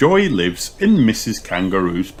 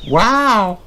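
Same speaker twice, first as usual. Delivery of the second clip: very high louder wow; squeaky awe; bunny amazed; amazed gasp; 5 dB louder than usual. A narrator's reading. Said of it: "gasped Rebecca".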